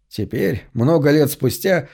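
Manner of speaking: ordinary delivery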